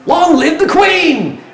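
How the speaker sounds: long, drawn-out high cheer; townsfolk yelling. Acted. Game master as a loyal townsperson in the streets, yelling. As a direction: shout it slowly across the whole town. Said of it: "Other voices elsewhere".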